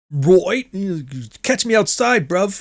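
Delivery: high, shrill mock Cockney. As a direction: imitate a shrill British woman in Cockney. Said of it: "British women ... shrillest Cockney".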